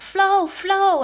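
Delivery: high, loud cry for help; retold scream. Attacked woman screaming to Flo in her room, high retold yell. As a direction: re-enact yelling out for a flatmate. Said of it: "and I'm screaming and I'm yelling".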